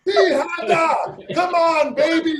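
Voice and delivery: high, loud hype shout; rock star shout. Retold or imitated. Drummer hyping a silent crowd, loud rock star shout. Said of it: "I went".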